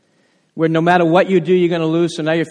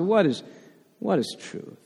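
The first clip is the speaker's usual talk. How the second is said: dismissive Pilate; 8 dB quieter than usual. Acted.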